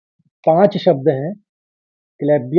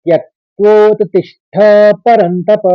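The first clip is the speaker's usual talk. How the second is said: shloka recitation; 4 semitones higher, 4 dB louder than usual. Shown as a demonstration. Teacher reciting the third and fourth lines together. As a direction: chant the second half of the verse. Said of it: "अब तीसरे और चौथे के सुनिए".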